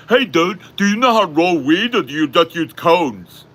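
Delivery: loud, high-pitched mimicry of a clueless dude; mock someone butting in, loud and high; mocking imitation. Imitating someone interrupting a street conversation, mocking and loud.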